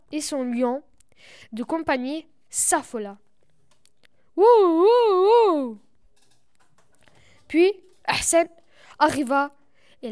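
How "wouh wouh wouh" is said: genie. Pretend. Magic moment, lion panics.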